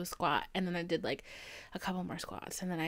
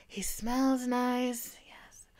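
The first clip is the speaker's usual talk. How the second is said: slow dreamy whisper; 2 semitones higher, much slower than usual. Real, not acted. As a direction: whisper it like a secret. Whispered aside.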